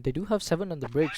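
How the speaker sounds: ordinary talk, nothing marked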